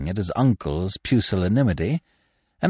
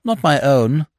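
ordinary delivery

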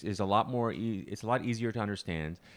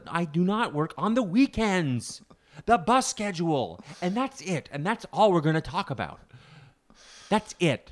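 stubborn insistence; 7 semitones higher, 4 dB louder than usual. Retold or imitated. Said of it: "He goes".

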